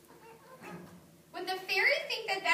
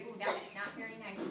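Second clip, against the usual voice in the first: ordinary delivery, nothing marked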